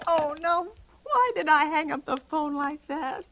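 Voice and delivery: usual speaking voice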